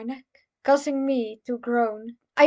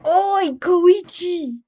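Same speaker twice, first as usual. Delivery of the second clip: higher, louder call across a distance; calling out from afar; 6 semitones higher, 6 dB louder, much slower than usual. Acted. Okuyasu calling Koichi from a distance. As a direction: shout a name from down the street. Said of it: "we both heard from a distance".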